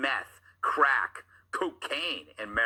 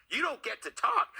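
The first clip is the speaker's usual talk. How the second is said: much higher prim scolding voice; mock scolding; 13 semitones higher than usual. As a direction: play a stuffy librarian shushing. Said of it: "is what I tell hookers".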